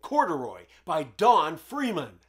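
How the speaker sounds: put-on bright, upbeat host voice for children; cheerful children's TV host greeting; kids show host.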